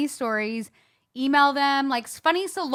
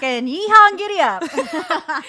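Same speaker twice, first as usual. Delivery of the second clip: loud, slow cowboy Western twang; hammed-up Western accent, drawn out; 5 semitones higher, 7 dB louder, slower than usual. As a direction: whoop it like a mock Western cowboy. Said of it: "I sound like Western".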